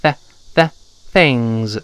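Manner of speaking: things starting on a clear th; slow drilled th into things; tongue-out TH. Shown as a demonstration. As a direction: poke the tongue tip out and pull it back for things. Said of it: "把舌尖吐出来一点儿，往回一缩".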